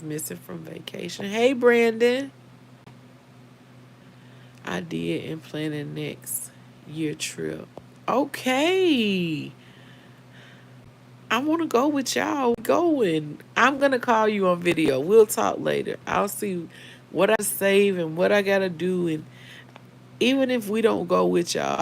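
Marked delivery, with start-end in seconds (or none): 1.3-2.3 loud, drawn-out, higher hello; called-out greeting. Real, not acted. Greets a viewer joining the live. 8.1-21.8 loud, lifted chat to a friend; big, loud, eager reply; calling out eagerly. Real, not acted. Answers Brandon about joining next trip.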